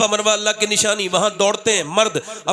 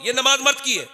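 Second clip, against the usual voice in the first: ordinary delivery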